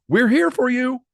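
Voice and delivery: high excited squeal; squealing friend. Acted. Best friend Emma squealing support, high. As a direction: squeal it with delight. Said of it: "Emma squeal".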